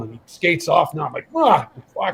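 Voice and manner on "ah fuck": frustrated curse. Retold or imitated. Player shouts in frustration after being brushed off, sharp curse. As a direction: blurt it out, fed up.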